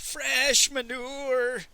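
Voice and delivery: high, long-drawn mournful hawker's call; old peasant's cry. A narrator's reading. Old peasant hawking from his cart, crying out. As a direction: stretch it out high and sorrowfully. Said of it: "the old peasant cried".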